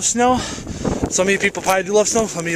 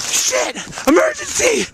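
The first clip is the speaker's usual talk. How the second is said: mock panic; 14 semitones higher than usual. Real, not acted. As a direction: sound the alarm over the camera. Camera covered in snow.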